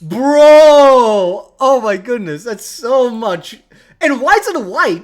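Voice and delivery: loud, high, drawn-out outcry; shocked disbelief. Real, not acted. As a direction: react aghast, voice jumping up. Shocked by picture of slimy hand.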